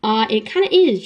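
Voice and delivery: usual speaking voice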